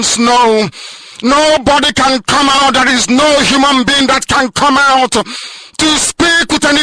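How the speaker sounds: high, loud fiery sermon cadence; preaching at full force. Acted. Leader rallying listeners on radio, preaching at full force. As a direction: thunder it out like a pulpit preacher.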